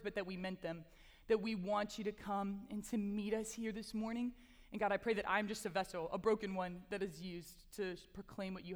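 low, softening prayer voice; heartfelt prayer. Real, not acted. Speaker prays to God before teaching, humble prayer.

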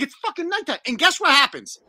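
usual speaking voice

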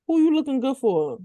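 high mocking tease; teasing dad voice. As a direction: needle her like a nosy dad. Dad's teasing quote.

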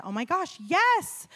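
gasped-out delight, jumping high on yes; high, loud burst on the last word; excited relief. Retold or imitated. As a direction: imitate a thrilled acceptance. Director's retold relieved reply.

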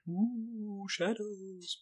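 high, hushed ghostly ooh; spooky ooh. Pretend. Host teases foreshadowing with a soft spooky wail. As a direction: breathe it out like a mock ghost.

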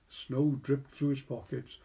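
ordinary delivery, nothing marked

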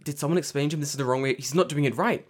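hammed-up alarm, quick and rising; mock panic. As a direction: imitate a flustered bystander getting frantic.

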